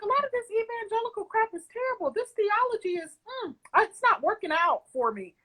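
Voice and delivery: high-pitched, acted disapproval; mock fed-up verdict, easing down at the end; retold exasperation. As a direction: imitate your younger self getting fed up. Quotes own past rejection of theology.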